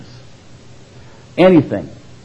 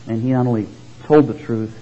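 ordinary delivery

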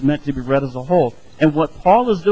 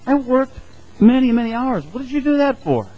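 high, indignant cry over ruined work; upset protest; 9 semitones higher than usual. Retold or imitated. Pastor voicing someone whose work got wrecked, high and indignant. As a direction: wail it out like your project was trashed. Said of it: "how would you feel? It's like".

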